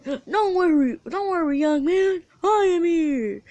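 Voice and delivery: All Might. Acted. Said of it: "then All Might comes in".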